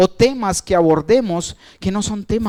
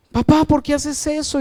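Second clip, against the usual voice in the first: crying daughter; 8 semitones higher than usual. Retold or imitated. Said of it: "Y lloraba".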